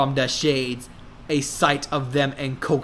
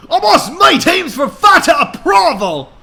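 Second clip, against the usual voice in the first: angry dad; 9 dB louder than usual. A narrator's reading. Billy Ray angrily demanding to meet the boyfriend. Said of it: "is angart".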